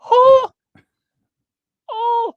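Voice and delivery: very high, loud mock gasp; audience gasp. The audience gasping at the husband's presence, very high mock gasp. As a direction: gasp like a stunned crowd.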